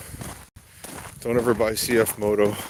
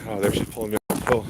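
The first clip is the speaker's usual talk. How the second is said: ordinary talk, nothing marked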